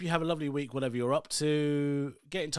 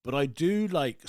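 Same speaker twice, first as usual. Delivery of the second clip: ordinary talk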